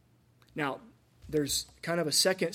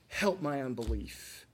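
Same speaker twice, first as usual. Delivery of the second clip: a gentle, lowered request for help; humble prayer; slower than usual. Shown as a demonstration. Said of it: "what we should ask is".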